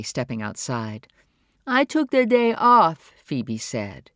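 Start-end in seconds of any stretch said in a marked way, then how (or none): 1.7-3.1 put-on higher, slow, simple young woman's voice; Phoebe's voice. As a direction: say it slowly and plainly, higher up. Phoebe answers her mother.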